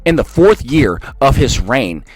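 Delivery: low, slow, solemn Bible reading; scripture reading. Acted. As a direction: intone the prophecy gravely. Reads scripture aloud.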